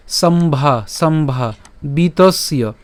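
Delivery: slow সম্ভা বিতস্য in separate chunks; model pronunciation. Shown as a demonstration. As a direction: draw out "sombhaa", then "bitosyo". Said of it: "আমরা এখানে উচ্চারণ করার ক্ষেত্রে".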